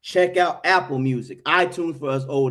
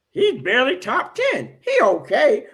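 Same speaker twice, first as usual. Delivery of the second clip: high-pitched dismissive mimicry; mock the naysayers in a raised voice; mocking doubters; 7 semitones higher than usual. Host parrots critics of Mike Evans, high mocking dismissal.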